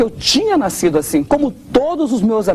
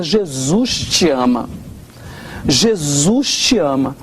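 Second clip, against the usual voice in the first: slow, low, tender and deliberate; mother's tender words; 6 semitones lower, much slower than usual. Retold or imitated. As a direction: say it gently, like a mother reassuring her son. Mother's loving words on phone. (devagar, grave e carinhoso, como mãe)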